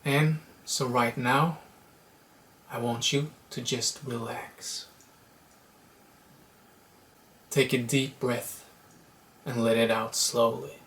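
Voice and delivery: unhurried meditation guide with long pauses; guided meditation. Acted. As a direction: guide them gently into letting go, leaving space between phrases.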